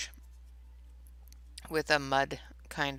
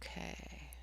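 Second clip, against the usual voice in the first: whispering; 16 dB quieter than usual. Real, not acted. Quiet while concentrating.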